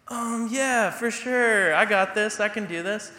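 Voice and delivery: high, loud bluffing bravado; fake confidence. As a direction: oversell it like you're sure you can.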